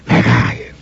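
voiceless, annoyed whisper; angry hissed whisper. A narrator's reading. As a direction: whisper it crossly. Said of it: "suszogta neki mérgesedve". (mérges suttogás)

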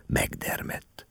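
ordinary delivery

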